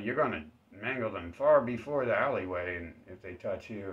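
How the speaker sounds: normal talking voice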